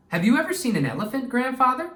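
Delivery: put-on a girl eagerly asking her grandfather about elephants; eager child's voice. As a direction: rush the question like an eager little girl. Child Anjali asks grandfather.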